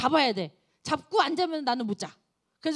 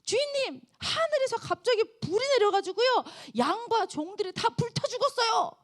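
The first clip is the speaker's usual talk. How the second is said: much higher, urgent report; second servant; 9 semitones higher than usual. Acted. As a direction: rush in shouting the disaster. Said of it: "다른 종이 딱 들어와요". (아주 높은 다급한 종의 목소리)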